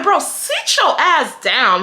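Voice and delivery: slow, high, scornful put-down; slow mocking scorn at the boyfriend; angry put-down. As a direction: drag each word out to put him in his place. Telling off the boyfriend.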